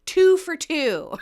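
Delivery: slow, loud, high mock shout; imitating someone shouting; retold shout. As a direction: shout it out triumphantly, drawn out. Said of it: "last night he kept shouting".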